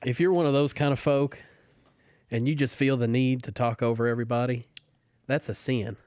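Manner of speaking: folksy Southern church lady listing sins; Southern accent. Pretend. Closing statement for the podcast game in a Southern accent. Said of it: "You have to do it in a Southern accent".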